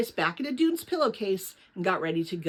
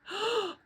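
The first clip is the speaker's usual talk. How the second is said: a quick, high gasp of realization; sharp gasp; 11 semitones higher, 5 dB quieter than usual. Acted. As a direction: catch your breath as it hits you. Lena realizes she forgot note.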